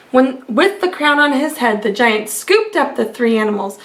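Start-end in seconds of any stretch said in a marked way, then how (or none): none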